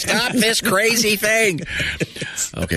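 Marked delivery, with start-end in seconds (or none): 0.0-1.6 high, slow cartoon panic; George Jetson. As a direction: yelp it like a trapped cartoon dad. George Jetson line on treadmill.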